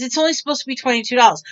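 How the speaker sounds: hurried objection. Retold or imitated. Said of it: "I says".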